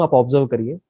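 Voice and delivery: normal talking voice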